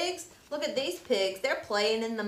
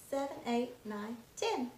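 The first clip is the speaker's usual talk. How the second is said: usual speaking voice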